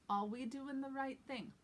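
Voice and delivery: "Are" said as a New York "aw"; dropped R. Shown as a demonstration. Teacher models a practice line, dropped R. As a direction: open "Are" into a vowel with no R. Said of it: "You don't even say r".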